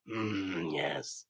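low, slow wrinkly rasp; old Emperor voice. Retold or imitated. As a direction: croak it like an ancient Emperor. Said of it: "a wrinkly fuck on a chair going".

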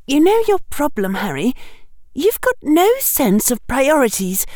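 put-on high, scolding young girl's voice; Hermione, acerbic. A narrator's reading. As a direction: lecture him with tart impatience. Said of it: "voice sounded a little acerbic".

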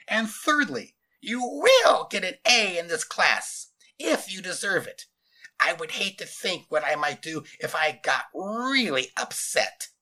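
loud, high, strangled command; slow, strangled demand; demanding scratchy voice. A narrator's reading. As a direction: stretch out each order, scratchy and forceful. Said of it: "demanding, scratchy voice".

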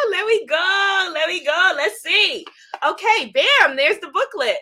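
high, loud, eager chant into a reveal; real excitement. Real, not acted. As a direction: hype up the unboxing. Excited unboxing of the deck.

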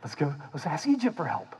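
high, fretful cartoon voice; hammed-up frantic people; frantic crowd. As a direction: babble in fake panic, then settle on Egypt. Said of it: "and they're like".

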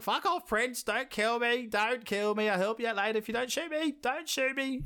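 high-pitched frantic bird begging for its life; pleading drake. Acted. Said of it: "you gotta think it's like".

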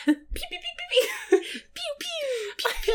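high laser pews; rapid blaster noises; pew sound effects. Pretend. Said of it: "that's my job".

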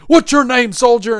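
high, loud mock drill sergeant; hammed-up sergeant yell. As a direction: bark it at the new recruit. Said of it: "this sergeant comes up".

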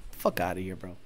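very low, soft brush-off; muttered dismissal. Real, not acted. Tuner brushing off the mocked owner, low muttered dismissal. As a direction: mutter it away under your voice.

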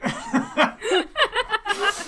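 high giggling burst; high laugh. Real, not acted. Laughing at own joke.

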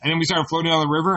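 ordinary talk, nothing marked

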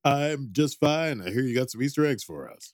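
loud, fast game-show host intro; hammed-up TV announcer welcoming viewers. Mock show host continues.

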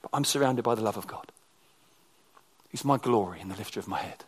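low, soft, assured voice; hushed, settled trust; humble confidence. Retold or imitated. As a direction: say it quietly, without any triumph. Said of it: "he's not triumphalistic, he's not cocky".

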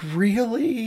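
high, falling note of dismay; disbelieving. Retold or imitated. Filmmaker hearing his partner will sell shoes, disbelieving. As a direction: pitch it way up in mock disbelief.

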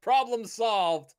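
high, proud mock boast; boastful hero. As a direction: announce a triumph with mock swagger. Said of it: "and saying".

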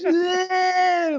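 high-pitched "bleh" of revulsion; imitated disgusted cry. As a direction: act out spitting out mouthwash. Acts out rinsing with mouthwash.

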